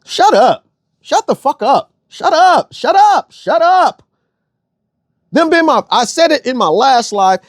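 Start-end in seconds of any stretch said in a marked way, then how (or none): 0.0-4.0 rising exasperated shouts; shut up. Real, not acted. Telling the panel to shut up, building higher each time. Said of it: "Four words".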